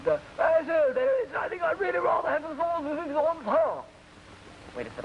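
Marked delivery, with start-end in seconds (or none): 0.4-3.9 put-on high, toff English accent; plummy Englishman. Englishman orders from the waiter in a toff English accent. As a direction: mumble like an upper-class Englishman. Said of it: "he said".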